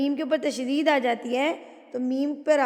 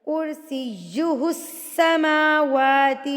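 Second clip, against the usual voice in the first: slow سیہ with held "yy", long "ss" into السماواتی; pressed doubled consonants; 4 dB louder, much slower than usual. Shown as a demonstration. Showing students tashdeed, doubled sounds pressed in کرسیہ السماواتی. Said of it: "زور دے کے پڑھنا ہے".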